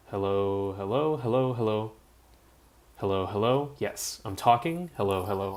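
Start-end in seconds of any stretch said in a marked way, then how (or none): none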